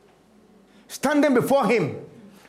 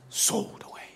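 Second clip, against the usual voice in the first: breathy whisper, much softer; 9 dB quieter than usual. Acted. Preacher hushing as Joseph is sold away. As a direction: let it drop to a whisper.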